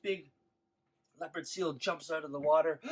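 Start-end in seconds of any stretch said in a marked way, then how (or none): none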